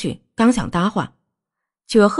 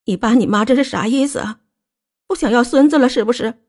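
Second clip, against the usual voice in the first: high-pitched indignant challenge; worked-up wife, voice climbing higher; agitated; 6 semitones higher than usual. A narrator's reading. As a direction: confront him in a rising, upset voice. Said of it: "穆小薇激动地对林子玉说".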